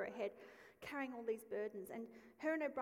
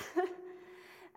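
a short loud laugh, pitched up; sudden laugh at herself; bright laugh; 8 semitones higher, 8 dB louder than usual. Real, not acted. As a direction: let out a quick real laugh. Laughs at own remark.

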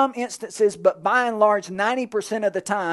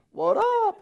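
casual greeting. Acted. Casual greeting as God speaking.

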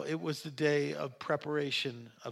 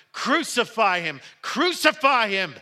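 loud chant climbing higher and slower; insistent shouting; 7 semitones higher, 9 dB louder than usual. A narrator's reading. Crowd will not let Pilate release Jesus, insistent shouting. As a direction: keep shouting, each cry bigger. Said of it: "they kept shouting".

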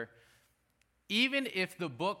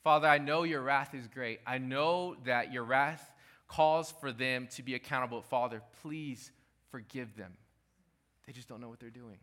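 earnest intercession, fading to a hush; tender pleading prayer; 2 semitones lower than usual. Pretend. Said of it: "Jesus said it like this".

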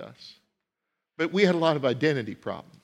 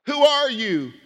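high, loud "Who are you?" with "are" punched; pressing question; 10 semitones higher, 4 dB louder, slower than usual. Shown as a demonstration. Preacher repeatedly pressing the congregation: who are you. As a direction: demand it with "are" stressed. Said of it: "If I were to say".

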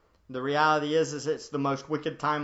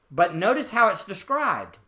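normal talking voice

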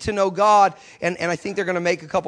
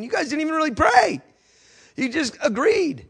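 high-pitched, exasperated protest; indignant reproach; 9 semitones higher than usual. Retold or imitated. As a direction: act out baffled indignation, slowing at the end. Indignant inner reproach, acted out.

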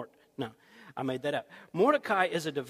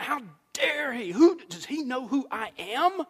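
higher, louder indignant outburst; mock furious king's man; 7 semitones higher, 6 dB louder than usual. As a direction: rage at the insult, acted. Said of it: "he's furious".

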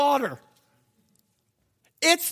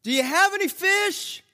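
calling out; 9 semitones higher than usual. Acted. Calling to boat far off.

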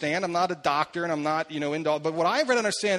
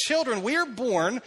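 normal talking voice

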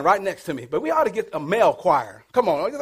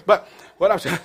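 normal talking voice

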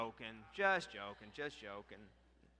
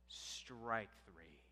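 ordinary delivery

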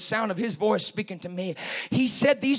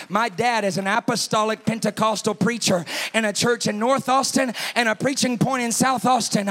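usual speaking voice

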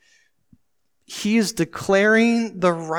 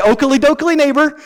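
lightly voiced chipper neighbor, pitched up; a hint of a cartoon neighbor, higher and louder; Ned Flanders; 7 semitones higher, 5 dB louder than usual. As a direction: toss it out like an over-friendly neighbor. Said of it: "They were the Ned Flanders neighbors".

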